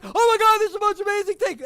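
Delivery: high, loud, fast gush of excitement; overexcited child. Retold or imitated. Mother imitating her little daughter, high and loud. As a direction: squeal like a thrilled four-year-old. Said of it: "how little kids are".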